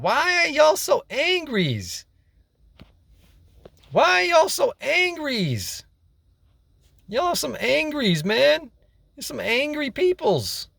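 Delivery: high-pitched, drawn-out mock complaint; exaggerated high-pitched mock bewilderment; playful mocking. Mocking angry people with silly wording.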